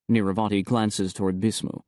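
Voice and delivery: plain reading voice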